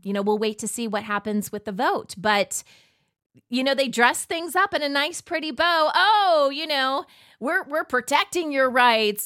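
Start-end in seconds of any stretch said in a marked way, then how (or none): 5.9-9.2 higher, exaggerated mock-reassuring voice; mock reassurance. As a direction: feign protectiveness, hitting the opening "Oh" loud. Voices agencies dressing up control.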